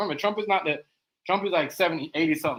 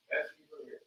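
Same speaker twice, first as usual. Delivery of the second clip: "Let's keep it real" breathed as a soft whisper; hushed catchphrase; 11 dB quieter than usual. Pretend. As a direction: drop to a secret whisper. Repeating catchphrase in varied ways.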